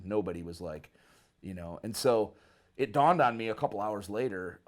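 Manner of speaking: ordinary delivery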